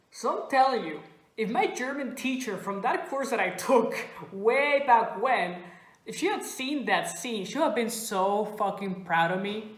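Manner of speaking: ordinary delivery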